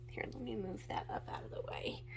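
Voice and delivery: fast whispered aside; quick whisper. Real, not acted. Vlogger muttering aside while moving palette.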